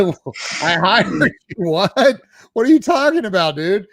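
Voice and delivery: acting totally lost, mock baffled; retelling his own baffled reply; baffled disbelief.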